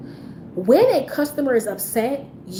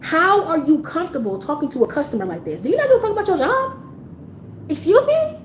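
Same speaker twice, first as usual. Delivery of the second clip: incredulous, rising sharply high; indignant questioning; 5 semitones higher than usual. Retold or imitated. Manager reprimanding a rude employee, indignant. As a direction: let each question climb into high disbelief.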